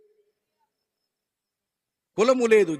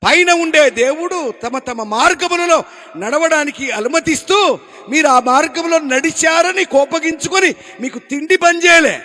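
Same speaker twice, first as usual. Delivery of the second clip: loud, higher pleading cry; loud pained appeal to the people; anguished plea; 4 semitones higher, 6 dB louder than usual. Acted. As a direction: cry out to the crowd in pain. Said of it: "చాలా వేదనతో చెప్తున్నాడు".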